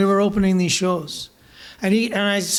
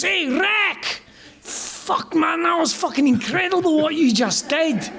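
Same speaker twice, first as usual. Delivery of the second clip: put-on Scottish accent; 8 semitones higher than usual. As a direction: shout it like a Scottish rocker in a thick accent. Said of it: "they've got really thick Scottish accents".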